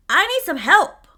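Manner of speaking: loud, insistent appeal; calling for help. Retold or imitated. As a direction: call it out loud to coworkers. Retold call to coworkers for help.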